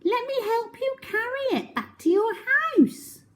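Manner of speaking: put-on very high chirpy girl; high helpful little voice; little Miss Quick voice. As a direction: squeak it up high and eager. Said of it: "she said".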